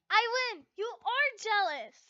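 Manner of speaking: high, loud gloating, slowing on jealous; loud sing-song taunt; triumphant teasing. Acted. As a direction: crow over your friend.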